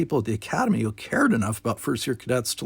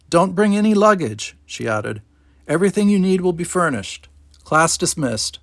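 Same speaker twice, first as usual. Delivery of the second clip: louder, higher brisk orders; instructor dismissing class; 6 semitones higher, 4 dB louder than usual. Acted. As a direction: wrap up the class crisply.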